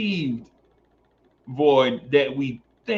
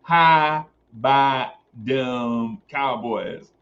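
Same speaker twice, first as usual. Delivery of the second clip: slow, drawn-out mock Texas accent; much slower than usual. Streamer imitating how fans say it in Texas, slow Texas accent. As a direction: stretch it like a Texan fan. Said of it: "That's how they say it in Texas".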